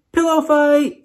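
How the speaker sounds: high, loud gleeful yell. A narrator's reading. Jackalope yelling to start a pillow fight, high and loud. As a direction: shout it as a battle cry. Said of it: "yelled Hazel".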